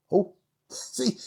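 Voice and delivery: usual speaking voice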